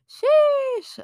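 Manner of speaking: long, very high squeal; exclaimed sheesh. Real, not acted. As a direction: stretch it out high and loud. Exclaiming over her accomplishment.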